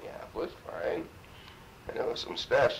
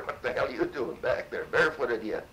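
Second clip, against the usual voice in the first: retold yell; 6 semitones higher, 5 dB louder than usual. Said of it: "I yelled at her".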